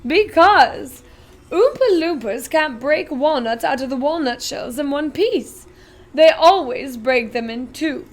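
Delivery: a giddy chocolatier showing off; Willy Wonka, grand and eager; eccentric Wonka. Acted. Wonka explaining.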